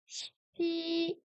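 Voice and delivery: Chi high and level, soft; a high flat Chi, first tone. Shown as a demonstration. Self-taught Chinese lesson, demo Chi on first tone. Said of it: "Chi premier ton".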